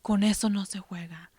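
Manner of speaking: quick whispered warning in Spanish; mom's warning. Retold or imitated. Mother to her kids, a quick whispered Spanish warning. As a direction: hush it fast like a mother's caution. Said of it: "and she says".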